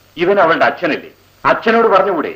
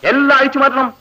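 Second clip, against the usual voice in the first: high, sneering mock official; mock minister; 6 semitones higher than usual. Seniors ragging juniors, high sneering mock authority. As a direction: lord it over them with a sneer. Mocking ragging of new girls.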